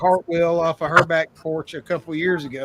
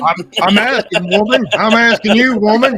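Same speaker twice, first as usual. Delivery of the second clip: loud, higher, insistent; loud demanding; 5 semitones higher, 10 dB louder than usual. Acted. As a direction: bark the question at her. Loud mock scolding at woman.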